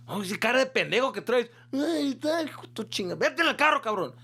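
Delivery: high, loud angry father voice; dad yelling. Retold or imitated. Father ordering his son into the car, high and loud. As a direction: scold him like a furious dad.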